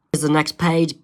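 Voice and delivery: plain reading voice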